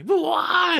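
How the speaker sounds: put-on high, slow, whimpering drunk plea; mock crying. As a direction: imitate a drunk man crying and begging. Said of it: "As he's, like, crying".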